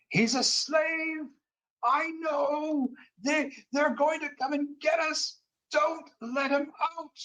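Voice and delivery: high, mild mock scream; a hint of a furious old man screaming. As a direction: rage from below in a high voice. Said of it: "literally screaming".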